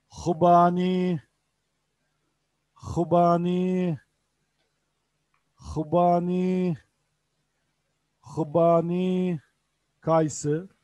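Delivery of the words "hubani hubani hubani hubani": Hubani said slowly with a throaty H; slow model word. Shown as a demonstration. Teacher drilling Hubani slowly, throaty H. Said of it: "teacher drills Urdu word".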